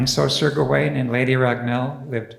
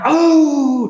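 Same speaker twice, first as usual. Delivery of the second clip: high, loud startled oh; a crowd's loud shocked gasp; 11 semitones higher, 7 dB louder than usual. Acted. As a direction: gasp as if seeing a monster. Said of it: "and they went".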